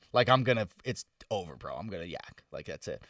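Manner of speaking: low, soft, almost breathless muttering; queasy defeat. Retold or imitated. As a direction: give up quietly like you're about to be sick. Quoting himself about to vomit.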